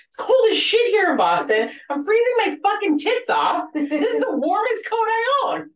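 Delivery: hammed-up quote of the cursing patient, high and loud; imitating the patient. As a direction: nurse replays the patient's reason for the fur coat, high and loud. Retelling patient's blunt fur explanation.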